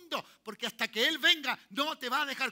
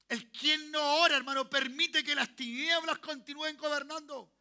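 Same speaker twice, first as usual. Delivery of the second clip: fervent preaching; 4 semitones higher than usual. Acted. Preacher urging the congregation to pray, fervent preaching. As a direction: preach it hard to the hall. Preacher builds to full force.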